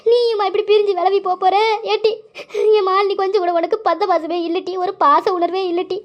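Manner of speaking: high-pitched wailing reproach; tearful lament. Acted. Hurt woman reproaching Malini for drifting away, crying. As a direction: plead through tears, voice lifted high. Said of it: "சரி சரி அழுவாத".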